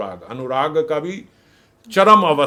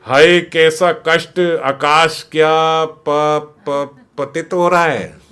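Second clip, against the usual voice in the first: slow, stumbling over syllables; stammering intoxicated lament; drunk slurring; 4 dB louder, much slower than usual. Retold or imitated. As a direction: drag it out like a tipsy lover. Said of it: "एक एक वर्ण को तीन तीन बार भी बोल रहे हैं".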